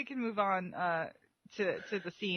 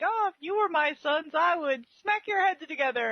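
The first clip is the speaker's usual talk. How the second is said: mock scolding mother; 9 semitones higher, 3 dB louder than usual. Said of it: "randomly being like".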